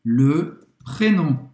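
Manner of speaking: "Le prénom" drawn out, nasal "nom"; slow model word. Shown as a demonstration. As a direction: say "Le prénom" slowly for learners. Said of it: "a gente falou prénom". (pronúncia lenta de "Le prénom")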